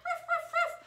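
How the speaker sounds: high yappy woofs from the puppet; puppy barking. Acted. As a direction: bark like a small excited dog. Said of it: "Doggy is here today".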